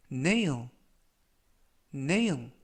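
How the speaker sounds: nail said as NAYL, with a long ay and a dark l; careful English pronunciation. Shown as a demonstration. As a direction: model nail for learners to repeat. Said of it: "이라고 발음하시면 되겠고요".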